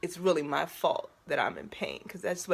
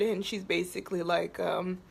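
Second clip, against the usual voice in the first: ordinary talk, nothing marked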